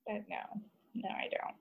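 nearly voiceless, trailing no; mumbled denial. Real, not acted. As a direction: barely breathe out the denial. Denies online dating, voice drops away.

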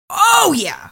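high, loud, emphatic yes; emphatic retold agreement. Podcaster as prosecutor answering the captain, high emphatic agreement. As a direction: imitate someone agreeing with total relish.

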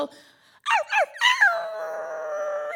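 very high yelping arrs, the last drawn out; imitated playful dog noise. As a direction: yelp like a dog begging to play. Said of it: "there's this random, she'll go".

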